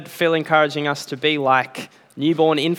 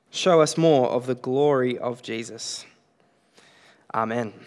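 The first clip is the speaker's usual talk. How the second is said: prayer; 3 semitones lower than usual. Acted. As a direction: pray gently and unhurried. Opening prayer.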